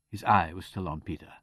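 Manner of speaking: usual speaking voice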